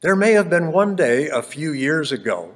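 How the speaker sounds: rhythmic Dr. Seuss style reading; bouncy galloping verse. Acted. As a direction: bounce through the rhymes playfully. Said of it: "wrote in Anapestic tetrameter, and it has that rhymy sound".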